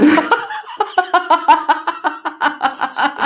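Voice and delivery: long laughing fit. Real, not acted. Guest laughing at hostess asking about mom, long high laugh.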